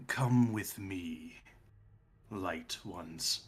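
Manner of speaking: slow, low and hushed; eerie cultist figure. Acted. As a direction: speak as an otherworldly guide, softly. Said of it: "He sounds like Omu".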